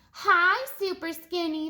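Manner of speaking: loud, mocking greeting; teasing call. People making fun of Stanley, calling out mockingly. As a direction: taunt him from across the street. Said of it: "they would call".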